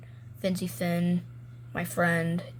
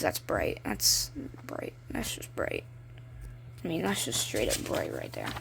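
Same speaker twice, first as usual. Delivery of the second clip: hushed mutter; 9 semitones lower, 7 dB quieter than usual. Real, not acted. Squinting at bright page, muttering.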